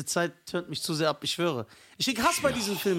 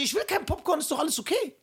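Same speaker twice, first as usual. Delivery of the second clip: much higher, defensive quoted voice; high, placating mock protest; retold protesting refusal; 8 semitones higher than usual. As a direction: act out waving off an offer, high-pitched. Retells refusing the popcorn.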